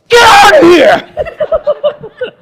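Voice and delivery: yelling the intruder out, high and loud; high frantic yell with a laugh in it; shouting. Retold or imitated. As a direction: scream it, half laughing. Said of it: "I got like".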